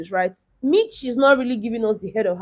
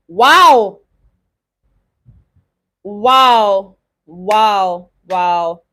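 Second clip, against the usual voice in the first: stunned, louder wow; shocked wow; 6 dB louder, much slower than usual. Real, not acted. Shocked at disqualification news.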